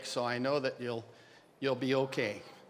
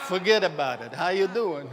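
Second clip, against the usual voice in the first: louder, hammed-up New York wise-guy talk; New York accent; 3 semitones higher, 6 dB louder than usual. Lecturer riffing on New Yorkers for the audience, hammed-up New York accent. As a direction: lay the New York accent on thick and brash. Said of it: "And the New Yorker goes".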